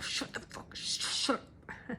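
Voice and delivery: hushed whispered shush; whispered mock command. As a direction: hiss it under your breath to shut it up. Brain silencing the heart.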